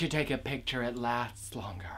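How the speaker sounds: voiceless whispered punchline; whispered jab. Acted. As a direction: sneak in the insult.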